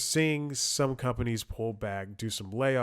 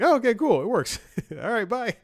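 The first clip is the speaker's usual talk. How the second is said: very high, loud mock-cheerful voice; exaggerated high-pitched relief; chirpy retold sign-off; 13 semitones higher, 9 dB louder than usual. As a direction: squeak out a hasty happy goodbye. Acting out his cheerful quick sign-off.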